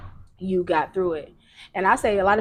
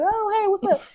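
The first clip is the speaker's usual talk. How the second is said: acting regular; 11 semitones higher than usual. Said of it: "I'm, I'm regular".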